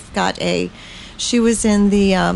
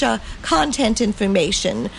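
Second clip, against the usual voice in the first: normal talking voice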